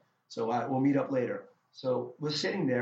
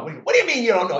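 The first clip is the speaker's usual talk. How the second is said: high, loud mock outrage; imitated exasperated yelling; Don Rickles; 9 semitones higher, 12 dB louder, much faster than usual. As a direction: lay into the volunteer for not knowing. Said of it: "He's just completely going off on me".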